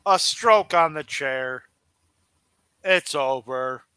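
ordinary talk, nothing marked

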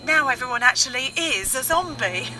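usual speaking voice

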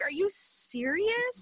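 high, drawn-out disbelief; incredulous. Retold or imitated. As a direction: stretch it out in high-pitched disbelief. Acting out her incredulous reaction.

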